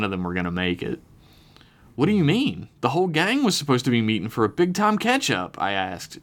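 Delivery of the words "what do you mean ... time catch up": higher-pitched disbelief; taken aback, pitch jumping up; shocked. A narrator's reading. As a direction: ask it like you can't believe what you heard. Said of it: "genuinely pretty shocked".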